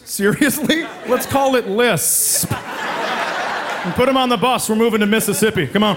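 mock namer. Mocking lisp namer.

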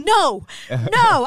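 high, loud mock refusal; retold angry refusal. Retelling refusing an apology while still angry, loud high protest. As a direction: cut off the apology with an exaggerated protest. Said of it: "you're still angry".

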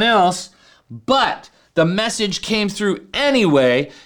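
fervent sermon reading; impassioned scripture reading. Acted. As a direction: preach it with rising fervor. Reading Paul's words at full force.

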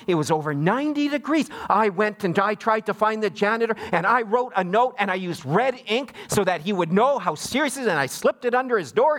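high-pitched imitation of an indignant aunt; mock outrage, pitch swooping on key words; all worked up. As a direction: rant like an aggrieved aunt recounting her ordeal. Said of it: "she was all worked up".

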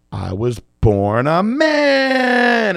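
slow, high, drawn-out reveal; mock dramatic confession. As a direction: savor the shock line. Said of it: "she's like".

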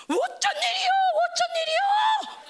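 very high, drawn-out wail; hammed-up high-pitched alarm; panicked sea. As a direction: cry out like the sea in turmoil. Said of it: "그러니까 막 바다가".

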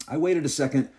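plain reading voice

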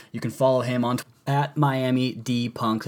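usual speaking voice

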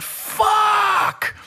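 an anguished, high "Fuck!" shouted; reliving the quarterback going down, yelling in dismay; retold anguished shout. Fan recalling seeing Carson hurt, anguished yell. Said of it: "and I went".

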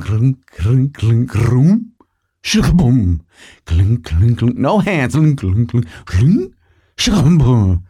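clunking mouth sounds of a horse cantering; playful imitated hoofbeats and a boom for the jump; hoofbeat noises. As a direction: clop it out like hooves then a big landing. Imitating horse hooves jumping.

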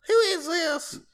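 very high-pitched mock mom voice; mom on the phone. Friend pretending to be his mom picking up, high mock voice. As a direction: squeak it like a puzzled mom answering. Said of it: "Let's call your mom real quick".

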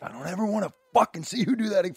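imitating his furious father; retelling how his dad swore at him, a mild angry voice; mild mock angry dad. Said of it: "was like".

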